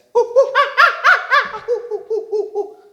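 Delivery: loud, high monkey cackle; monkey laugh. A narrator's reading. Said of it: "that's what monkeys say".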